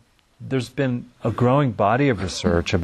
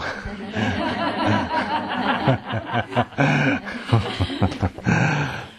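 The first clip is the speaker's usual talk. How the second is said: a long, real laugh at her own joke; amused laugh; 6 semitones higher than usual. Real, not acted. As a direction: laugh it off warmly. Laughs after her demo.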